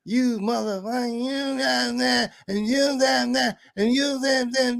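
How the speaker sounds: high, drawn-out cussing imitation; angry woman cussing. Rude woman cussing out the speaker, high and drawn out. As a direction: stretch out her rant mockingly. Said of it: "She was coming the wrong way".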